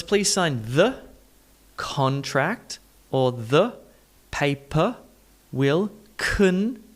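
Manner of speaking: slow, piece by piece, "the" as thuh and "con" as kun; broken-down schwas. Shown as a demonstration. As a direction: break it up slowly, reducing "the" and "con" to uh. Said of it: "let's go a step deeper".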